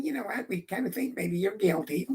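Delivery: soft, tentative mock verdict; meek judge. Meek judge giving an unconvincing verdict, soft tentative voice. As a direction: deliver it timidly, well under full voice.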